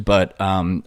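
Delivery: ordinary delivery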